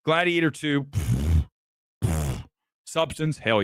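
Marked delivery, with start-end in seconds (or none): 0.8-1.5 long puff of air through the lips; dismissive pfft. Real, not acted. Critic brushing off a nominee, dismissive raspberry puff. 2.0-2.5 short airy puff through the lips; dismissive pfft. Real, not acted. Critic waving away a nominee, dismissive pfft.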